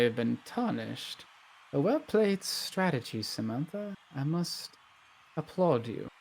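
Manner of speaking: soft, measured noble lady; approving noble lady. Acted. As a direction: applaud a shrewd move quietly and knowingly. Plays Lady Blackburn, complimenting.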